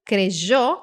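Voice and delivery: "creyó" said kre-ZHOH, buzzing zh. Shown as a demonstration. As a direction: buzz the y of "creyó" as zh. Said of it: "só com J".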